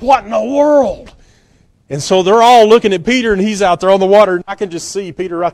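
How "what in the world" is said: high, slow, drawn-out amazement; a stunned Nathaniel staring from the boat; amazed disciple. Retold or imitated. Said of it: "he's like".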